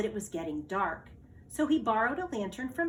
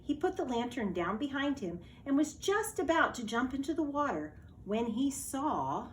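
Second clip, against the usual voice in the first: usual speaking voice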